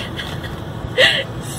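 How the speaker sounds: breathless laugh half into a cry; teary laugh. Real, not acted. Owner overwhelmed about adopting the kitten, teary laugh. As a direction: laugh through sudden tears.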